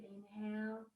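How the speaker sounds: ordinary delivery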